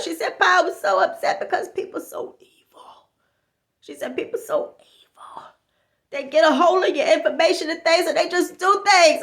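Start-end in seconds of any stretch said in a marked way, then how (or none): 0.4-5.4 softly whispered complaint; conspiratorial hush; mock whisper. Friend telling Pa about evil people, whispered.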